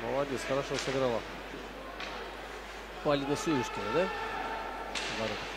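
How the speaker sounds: normal talking voice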